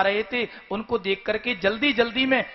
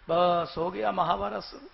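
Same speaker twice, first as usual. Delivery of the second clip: ordinary talk, nothing marked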